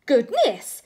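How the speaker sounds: a loud gasp of astonishment; surprised exclamation. Acted. Father sees the mouse.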